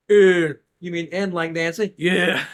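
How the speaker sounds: loud, laughing through the spelling; laughing retelling. Retold or imitated. Retelling a confusing phone call, laughing and loud. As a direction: chuckle while acting out the call.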